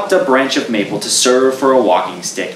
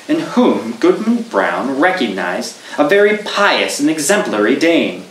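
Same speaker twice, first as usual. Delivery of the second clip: ordinary delivery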